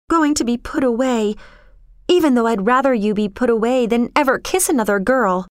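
put-on mildly impassioned girl's confession; passionate protest. A narrator's reading. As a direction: plead and explain, faltering midway. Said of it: "she protested passionately".

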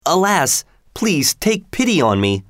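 a fisherman begging for mercy; pleading. A narrator's reading. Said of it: "said the fisherman".

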